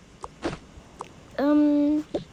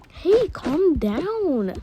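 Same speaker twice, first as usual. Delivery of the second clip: slow, higher drawn-out scolding; pretend yell; 5 semitones higher, much slower than usual. Older sibling hushing noisy siblings during a call, mock yelling. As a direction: stretch out a mock telling-off. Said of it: "I had to yell at them".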